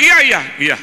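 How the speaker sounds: high, loud eager yes on the phone; acted excited yell; shouting. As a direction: blurt an over-eager acknowledgment. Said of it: "Pak Pendeta nggak usah teriak".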